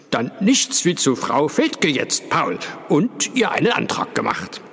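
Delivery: put-on heartiness, a touch overdone; false cheer. A narrator's reading. Father urges Paul toward Frau Fethke with forced jolliness. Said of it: "mit einer falschen Fröhlichkeit".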